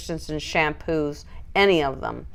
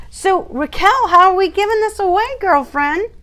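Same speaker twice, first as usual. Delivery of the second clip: much higher and louder, gushing; excited teasing; 12 semitones higher, 5 dB louder than usual. Real, not acted. Streamer calling out to sponsor Raquel about the giveaway, excited teasing. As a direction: call out to your friend all worked up.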